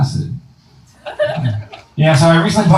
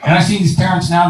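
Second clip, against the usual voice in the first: ordinary talk, nothing marked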